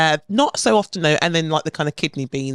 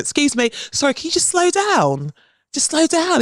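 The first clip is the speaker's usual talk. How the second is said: put-on high, pleading English accent throughout; proper English accent; 11 semitones higher than usual. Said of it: "I could hear myself sounding so English".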